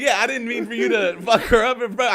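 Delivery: high, loud backpedalling protest; loud, high acted dismay; mock alarm. Comedian alarmed by the violence.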